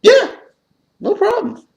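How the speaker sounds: very high breezy clerk voice; mock store clerk. Store clerk on the phone, a very high breezy mimic. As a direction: toss it off like it's nothing. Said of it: "And they were like".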